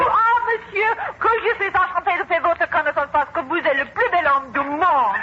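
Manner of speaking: a French delegate's gushing welcome again; French greeting. Acted. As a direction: greet him in fluent, flowery French. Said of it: "And he will say to you".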